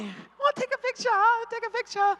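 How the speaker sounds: high-pitched mock Chinese fans; imitated crowding fans. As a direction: pipe up high, pestering for a photo. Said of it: "they crowd around me".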